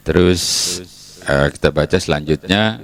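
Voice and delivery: ordinary delivery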